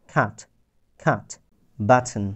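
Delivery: খাট and বাটন with a short stressed a. Shown as a demonstration. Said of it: "এইটাতে একটু stress দিতে হবে".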